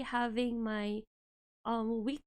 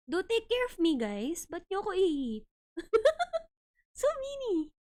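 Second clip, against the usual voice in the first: cute plushie voice; 7 semitones higher than usual. Pretend.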